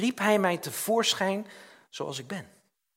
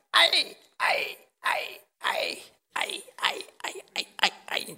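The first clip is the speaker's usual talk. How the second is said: mock pained cries falling into a whisper; pained ouches; 5 semitones higher, much slower than usual. Arrow being sharpened by God, mock cries of pain fading to a whisper. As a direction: wince at each stroke of the sharpening. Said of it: "Dat doet soms ook wel pijn".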